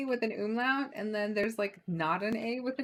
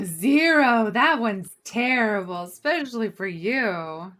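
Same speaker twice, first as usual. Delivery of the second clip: loud, slower exclamation; loud outburst; 11 dB louder, slower than usual. Real, not acted. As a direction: blurt it out loudly, then slow. Excited outburst about Zero.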